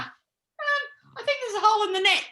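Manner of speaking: high-pitched mock worry, breaking into a laugh; retold nervous alarm. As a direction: voice the dawning alarm, high and comic. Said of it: "We're sitting there going".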